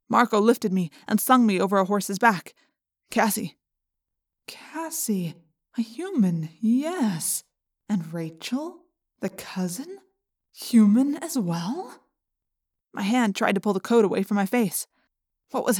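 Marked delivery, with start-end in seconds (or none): 3.1-12.0 whispery telepathic voice. A narrator's reading. Yeerk voice musing in head.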